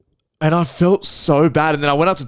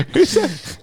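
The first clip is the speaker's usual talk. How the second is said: laughing, high voice. Real, not acted. Laughing at Netanyahu joke.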